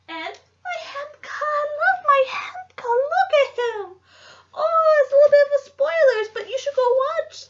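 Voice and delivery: gushing. Real, not acted. Showing off her beloved Hemka plush, a high delighted squeal. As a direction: squeal over a cute plush toy, voice way up.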